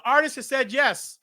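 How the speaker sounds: high, measured phone voice; mock-official high-pitched pronouncement; formal manager announcement. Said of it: "I hear Billy Sparks says".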